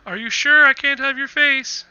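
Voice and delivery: high, loud abbot calling after departing guests; abbot character voice. Acted. Abbot asking the departing adventurers for a face, loud character voice. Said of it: "the abbot's like".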